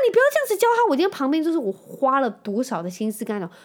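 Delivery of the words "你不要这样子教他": much higher and louder, pleading; exasperated protest. Real, not acted. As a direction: cry out at mom to stop. Scolding her mother.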